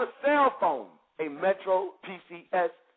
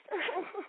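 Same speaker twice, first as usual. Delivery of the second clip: high short laugh; 9 semitones higher, 10 dB quieter than usual. Real, not acted. Laughs recalling the release.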